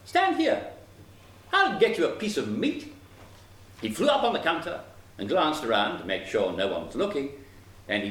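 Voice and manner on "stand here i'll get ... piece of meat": high, lilting little sparrow; chirpy helper sparrow, pitch swooping up; sparrow's voice. A narrator's reading. Sparrow promising the dog a piece of meat, eager. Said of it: "the sparrow said to the dog".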